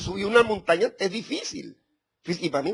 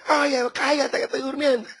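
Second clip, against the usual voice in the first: higher, laughing mock yell of a sleepy daughter; hammed-up higher yell with a laugh; 6 semitones higher than usual. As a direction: imitate your daughter shouting, half laughing. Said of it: "me gritaba mi hija".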